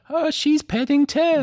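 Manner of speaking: put-on very high, drawn-out child voice; little boy's reply. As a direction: answer innocently in a tiny high kid voice. Voicing Jamie's reply.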